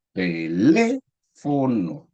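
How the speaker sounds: "teléfono" slowed right down, LÉ long and rising; stress on LÉ. Shown as a demonstration. Teacher showing an esdrújula, slow "teléfono" stressed on LÉ. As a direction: stretch the LÉ of "teléfono", then fall on FO-NO. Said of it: "la sílaba tónica está ubicada en la tercera sílaba".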